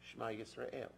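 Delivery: softly spoken Hebrew prayer; quietly said. Retold or imitated. Saying a private prayer while back at work, softly. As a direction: murmur it softly to yourself. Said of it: "I quietly said".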